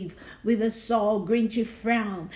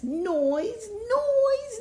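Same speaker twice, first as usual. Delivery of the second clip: rising squeal; 13 semitones higher than usual. A narrator's reading. Rising pitch on noise.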